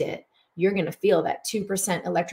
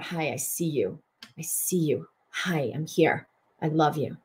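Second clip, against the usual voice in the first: soft, lower, tender greeting to the feeling; tender self-talk; 3 semitones lower, 5 dB quieter than usual. Retold or imitated. Speaker greeting her own sadness, soft and tender. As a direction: gently welcome the sadness, hushed. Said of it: "I breathe out".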